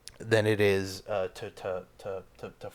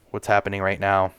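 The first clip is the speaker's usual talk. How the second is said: ordinary delivery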